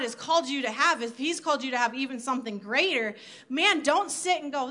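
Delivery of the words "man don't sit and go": ordinary talk, nothing marked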